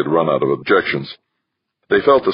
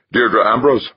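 higher, sharp curt call of the name; snapped; 8 semitones higher, 3 dB louder than usual. A narrator's reading. Sour officer curtly summons her, snapping. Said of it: "and snapped".

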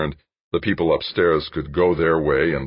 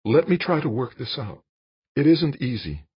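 high-pitched woman's plea; pleading; 10 semitones higher than usual. A narrator's reading. Jane begs Dan to be patient, high pleading voice. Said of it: "she pleaded".

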